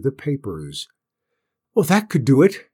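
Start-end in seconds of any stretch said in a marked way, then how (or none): 1.7-2.7 higher, louder burst of discovery; excited cry. A narrator's reading. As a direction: cry out with sudden inspiration. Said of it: "he cried".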